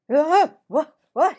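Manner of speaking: loud, high startled cry; jolted awake in confusion; sudden loud, high confused yelp; startled wake. A narrator's reading. Marvin waking upside down.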